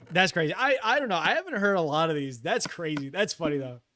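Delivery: ordinary delivery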